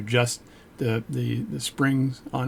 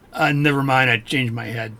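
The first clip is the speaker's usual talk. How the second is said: mock French accent; 3 dB louder than usual. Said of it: "stop doing stupid accents".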